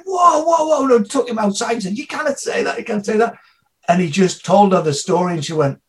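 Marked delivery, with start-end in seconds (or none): 0.1-3.4 much higher "whoa, whoa" then "You cannot say that"; alarmed teacher. Retold or imitated. Teacher stopping guest speaker mid-lecture, alarmed and higher. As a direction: pull him up in alarm. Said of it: "the fucking teacher ... went".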